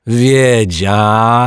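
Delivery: loud, very drawn-out high wail; despairing cry. A narrator's reading. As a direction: cry it out in despair, stretching the vowels. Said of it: "krzyknął zrozpaczony pan Mietek".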